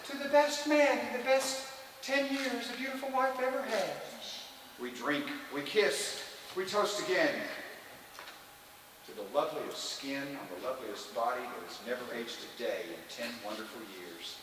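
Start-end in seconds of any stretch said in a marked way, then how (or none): 0.1-3.9 put-on high, soft woman's voice; wife's toast. Husband mimicking wife's anniversary toast, high and soft. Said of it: "She says". 9.1-14.3 low, soft, adoring toast; tender toast. Acted. Husband toasting his wife of ten years, low and tender.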